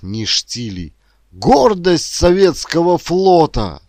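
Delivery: emphatic grandfather, swelling to loud high pride; impassioned old shipbuilder. A narrator's reading. Worker urging grandson to join the shipyard, impassioned. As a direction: plead and reproach with mounting fervour.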